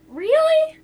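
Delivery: surprised. Real, not acted. Surprised at a result.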